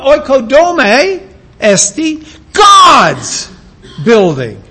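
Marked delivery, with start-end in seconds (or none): 2.5-3.5 "God's" shouted, much higher; stress on God's. Shown as a demonstration. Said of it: "Paul knew where to place his emphasis".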